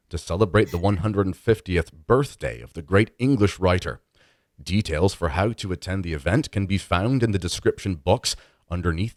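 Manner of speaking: regal Irish priest's promo read; Father Sherry, regal Irish accent. Shown as a demonstration. Host imitating his former prior reading a promo, regal Irish accent. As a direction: read it with stately Irish lilt, regal and deliberate. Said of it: "do Father Sherry's accent here? His sort of, uh, regal Irishness".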